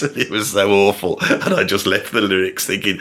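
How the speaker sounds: ordinary talk, nothing marked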